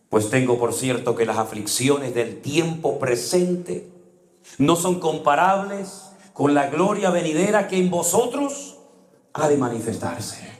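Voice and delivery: slow, deliberate reading of the verse; solemn verse recitation. Acted. Preacher reciting a Bible verse to the congregation, slow and solemn. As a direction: read the verse slowly and solemnly.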